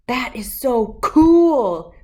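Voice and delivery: loud, slow, thrilled cry; a big-sister gasp of joy; excited exclaim. A narrator's reading. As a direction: savour every word of the delight. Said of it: "the oldest Emma exclaimed".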